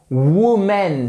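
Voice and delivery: "woman" mis-said slowly as woo-MEN; "woman", drawn-out French-style second syllable; wrong pronunciation. Shown as a demonstration. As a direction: show the mistake: "woman" with a full MEN ending. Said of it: "surtout pas".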